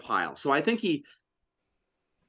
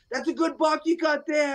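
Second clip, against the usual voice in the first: put-on little kid voice; 13 semitones higher than usual. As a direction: pipe up like a small kid. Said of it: "he's like".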